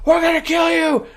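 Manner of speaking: high, loud death threat; hammed-up shouted threat; mock threatening yell. Retold threat at gunpoint.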